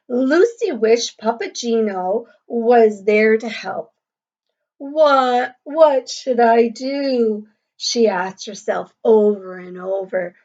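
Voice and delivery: clear, lively storybook reading to a class; reading to children. A narrator's reading. Teacher reading a picture book to the class, lively and clear. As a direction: read the story aloud to young pupils.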